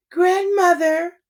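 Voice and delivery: put-on high, slow sugary girl voice from a wolf; wolf pretending to be a sweet child; wolf faking a little girl's call; fake little girl voice. A narrator's reading. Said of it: "in his best little girl voice".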